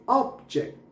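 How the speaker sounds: Object as OB-ject, high 'ob', dropping on 'ject'; stress on first syllable. Shown as a demonstration. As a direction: punch the start of object. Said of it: "जेक्ट पे थोड़ा कम हो जाएगा". (Object के पहले syllable पर ज़ोर)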